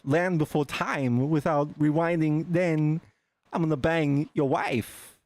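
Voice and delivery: Christopher Walken impression. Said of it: "we would say things like".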